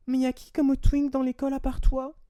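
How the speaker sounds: high, loud teasing imitation; mock provocative question. Girl answering back about the twink at school, mock taunting. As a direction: put on her pointed, high-pitched jab. Acting out her provocative reply.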